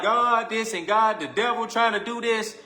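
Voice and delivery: high, slow mock whine; a hint of a pleading believer. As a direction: mimic the churchgoer blaming the devil. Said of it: "y'all who be like".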